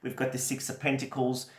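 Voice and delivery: normal talking voice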